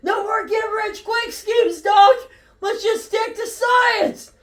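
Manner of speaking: high, slow shout over the engine noise. Acted. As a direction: call out over the roar. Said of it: "shouting over the roaring engine".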